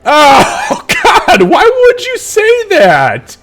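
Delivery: cracking up in horrified amusement; laughing disbelief. Real, not acted. Game master laughing at the player's gross Mind Flayer joke.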